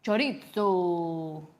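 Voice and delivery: "Chorizo" drawn out long and slow; "Chorizo" slowly, with a long final o; mimicked Spanish pronunciation. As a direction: stretch "Chorizo" in a put-on Spanish way.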